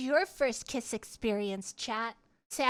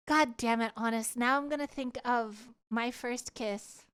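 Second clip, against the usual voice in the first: ordinary talk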